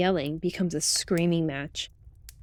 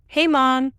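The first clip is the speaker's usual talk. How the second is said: raised, higher call to mom; louder, higher-pitched holler; yelling out; 6 semitones higher, 6 dB louder than usual. Acted. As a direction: call out loudly to someone in another room. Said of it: "I yell out".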